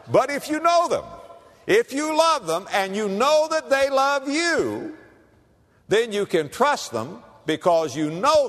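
high, ringing preacher's shout; preaching shout. Real, not acted. Preacher to congregation, on trust, shouting it out. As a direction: lift it up and proclaim. Preacher drives point home.

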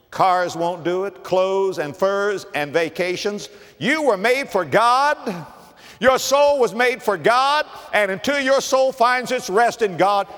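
building, high preacher's cry; impassioned preaching. Real, not acted. Preacher tells congregation their souls were made for God, impassioned. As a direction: preach it with rising force.

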